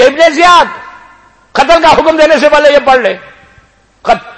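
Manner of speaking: high, loud urgent call; messenger's shout. Retold or imitated. Yazid's messenger calling to Ibn Ziyad at the door, loud urgent shout. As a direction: cry out to stop the execution. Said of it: "اس نے چیخ کے کہا".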